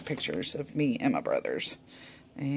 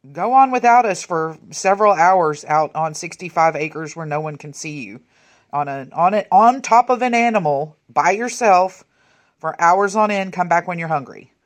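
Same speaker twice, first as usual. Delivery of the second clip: louder, emphatic pretend send-off; mock permissive parent; 10 dB louder than usual. Mock parent telling a child to go ride for hours, loud and emphatic. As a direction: play a parent loudly sending the kid off.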